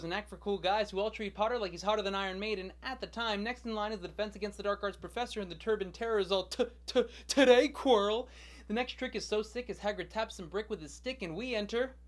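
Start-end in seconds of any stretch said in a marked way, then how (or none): 6.5-8.3 loud, higher stammer building to a shout; stammer, then burst out loudly; mock stuttering heckle. Impatient heckle of stuttering professor acted.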